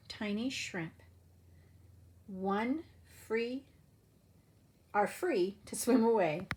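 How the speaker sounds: lively storybook read-aloud voice; animated picture-book narration; reading to children. Acted. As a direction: read it like story time for little kids. Teacher reads picture book aloud.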